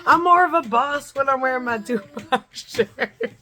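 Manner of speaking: high, loud, breaking into laughter; laughing. Real, not acted. Vlogger cracking up over her [name] shirt boast, laughing. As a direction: crack up while getting the words out.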